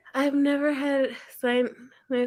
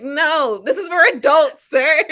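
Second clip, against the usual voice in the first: laughing mock scold, much louder; aunt pretending to be outraged at her nephew; mock outrage; 4 semitones higher, 10 dB louder than usual. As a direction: pretend to scold him, giggling. Mock scolding nephew over Lego.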